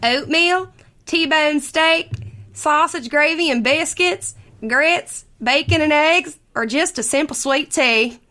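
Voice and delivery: sing-song Southern belle reading; Southern accent. Pretend. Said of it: "do this in solely Southern accents".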